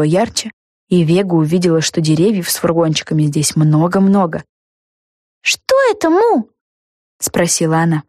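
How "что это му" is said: high, wondering question; girl voice. A narrator's reading. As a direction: ask uneasily. Vega asks the lollipop.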